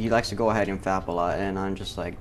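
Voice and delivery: usual speaking voice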